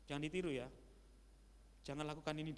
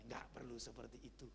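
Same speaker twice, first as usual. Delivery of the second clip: softer retold whisper from a colleague; 4 semitones lower, 8 dB quieter than usual. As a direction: lean in and murmur it privately. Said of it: "Dia bisikin sama saya".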